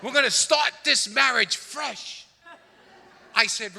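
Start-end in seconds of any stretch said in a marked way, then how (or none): 0.0-2.2 slow, louder, higher mock wife shout; excited shout. Mimicking his wife announcing a fresh start, slow loud shout. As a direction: proclaim it like a triumphant wife.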